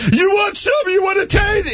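very high mock shout; hammed-up, high-pitched taunting; belligerent drunk. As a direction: squeal it like a drunk restarting the fight. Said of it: "it's that guy who has to then re-, re-engage".

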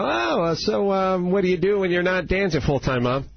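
mock polite chit-chat; awkward small talk voice. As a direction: play the nervous strip club customer. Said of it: "you know".

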